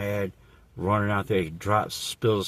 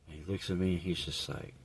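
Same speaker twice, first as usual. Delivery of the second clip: ordinary talk, nothing marked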